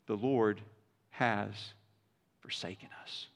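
fading from quiet to a whispered forsaken us; lowering to a despairing breath; mournful lament sinking to a whisper; pathos. Acted. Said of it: "There's such pathos in it".

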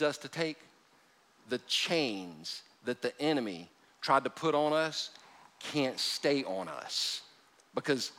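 slow, soft, weighty preaching; hushed sermon build. Acted. Preacher softly tells congregation chains fall off. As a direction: let the words sink in quietly.

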